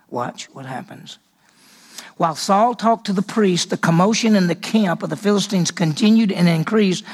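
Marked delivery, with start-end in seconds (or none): 0.1-1.2 much softer and lower teaser; quiet suspenseful hint; hushed. Real, not acted. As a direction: drop the voice to a hush. Building suspense for listeners.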